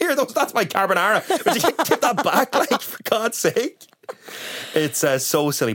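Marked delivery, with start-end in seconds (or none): 0.0-3.9 high-pitched, hammed-up indignant protest; mock annoyed. Cohost plays shopper robbed of groceries, mock annoyed. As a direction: play-act outrage, dragging out the end. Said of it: "you'd be more than a bit annoyed".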